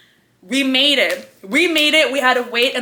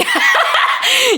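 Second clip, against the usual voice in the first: high laughing burst; 5 dB louder than usual. Real, not acted. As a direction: squeal of laughter. Laughing at own look.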